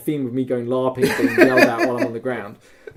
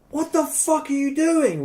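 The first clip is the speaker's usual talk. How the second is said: angry outburst; 13 semitones higher than usual. Retold or imitated. Yelling at shooters.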